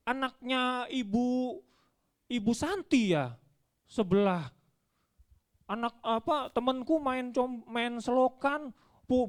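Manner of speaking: put-on high-pitched child arguing with a parent; complaining child. Child comparing himself to the neighbour's kid, high-pitched complaint. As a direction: complain like a kid who feels unfairly scolded, voice up. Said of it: "anakku bilang apa?".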